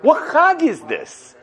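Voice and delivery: high, slowed questioning; lightly voiced scoffing Pharaoh; incredulous Pharaoh. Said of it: "he looked at Moshe and he said".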